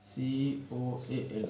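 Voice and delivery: letters read slowly one by one; dictating letters slowly; spelled out slowly. Shown as a demonstration. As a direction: spell the word slowly for the class. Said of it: "लिखना है कोल".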